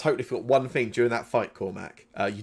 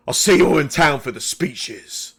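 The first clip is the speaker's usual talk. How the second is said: louder, higher parting shout to a crowd; raised-voice, irritated send-off; calling out; 4 semitones higher, 8 dB louder than usual. Acted. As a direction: call it out angrily as you leave. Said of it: "quite quickly just calls out".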